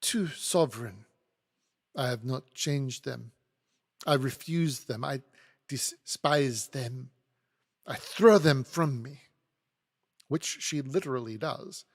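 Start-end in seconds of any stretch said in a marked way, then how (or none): 0.0-9.3 put-on scornful French accent, building louder; fierce disdain. A narrator's reading. Maid flings the money back, fiercely disdainful. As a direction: reject it with mounting contempt, French-accented. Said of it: "she repeats with fierce disdain".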